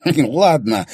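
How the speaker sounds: snorting grunt of agreement; grunted okay. Acted. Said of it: "хрюкнул Трульс Бернсон".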